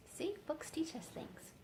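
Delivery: soft unvoiced whisper; hushed whispered remark. A narrator's reading. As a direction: breathe it as a secret aside. Whispered aside.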